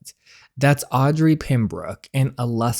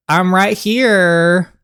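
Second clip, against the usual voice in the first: slow and louder, proud; excited reveal; 7 semitones higher, 7 dB louder, much slower than usual. Real, not acted. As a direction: announce yourself triumphantly. Revealing she's the author.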